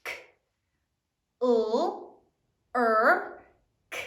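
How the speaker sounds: clerk as separate sounds: K, U, R, K; one isolated sound at a time, K, U, R, K. Shown as a demonstration. Teacher sounding out clerk for the class, K, U, R, K separately. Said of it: "Sound it out".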